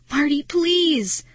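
high, slow begging cry; plead, drawing the last word out; desperate pleading. A narrator's reading. Girl begging unconscious Marty, desperate.

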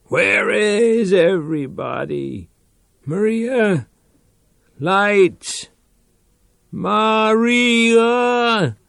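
high, pleading calls for Maria; plaintive calling. Acted. Reich alone, calling plaintively for Maria. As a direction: call out into the dark, lost and pleading. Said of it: "He called out plaintively".

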